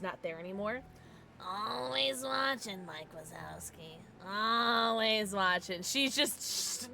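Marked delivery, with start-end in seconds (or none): 1.5-5.8 slow, stretched-out mock-ominous voice; creepy watcher impression. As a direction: draw out the words like a lurking watcher. Creepy movie quote about staring lady.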